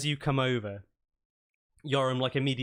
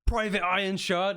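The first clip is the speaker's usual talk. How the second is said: high, raised voice of an outraged commander; shouting commander; 9 semitones higher, 4 dB louder than usual. Acted. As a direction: shout down an insubordinate private. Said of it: "in a shouting match with each other".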